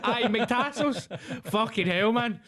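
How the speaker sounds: high, slow laughing repeat of the name. Real, not acted. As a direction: drag the name out through a laugh.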